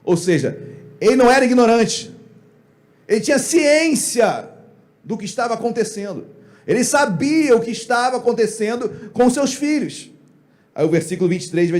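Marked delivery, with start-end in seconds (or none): 1.0-10.1 higher, loud and punchy sermon delivery; emphatic preaching. Real, not acted. Preacher insisting Eli knew about his sons, emphatic preaching. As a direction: drive it home to the congregation.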